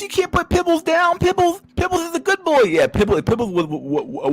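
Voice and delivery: mock a pit bull lover defending her pet; squeaky mock pet-owner voice; doting owner.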